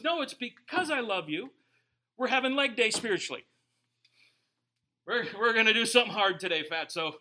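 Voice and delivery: loud, fast gym-coach push; loud coach voice for God. Retold or imitated. God as trainer pushing the believer, loud. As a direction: bark it like a trainer on leg day. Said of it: "and he goes".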